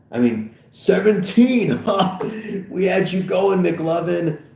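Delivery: gleeful high exclaiming; laughing tease. Acted. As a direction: rib him between laughs. Officer cracking up, teasing Fogel.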